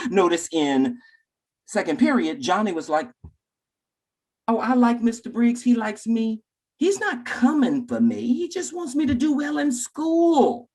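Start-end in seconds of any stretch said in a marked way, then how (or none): 4.5-10.7 put-on high-pitched trusting kid voice; imitated pupil. Johnny explaining he likes his teacher, high imitated child voice. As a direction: pipe up like a contented child.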